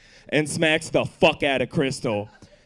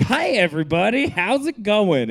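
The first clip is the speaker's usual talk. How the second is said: put-on cheery old man; 6 semitones higher than usual. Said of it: "kicks open the door and is just like".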